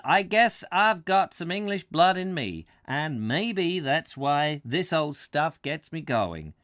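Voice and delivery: put-on light New England American accent, lively. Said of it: "the American talking".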